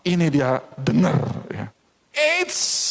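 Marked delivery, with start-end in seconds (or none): 2.1-2.8 sister's taunt. Acted. Sister stops him again.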